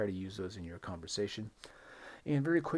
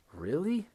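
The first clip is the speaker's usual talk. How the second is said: "Really?" jumping high and loud; a surprised, high-rising "Really?"; 9 semitones higher, 5 dB louder than usual. Shown as a demonstration. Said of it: "this is the opposite".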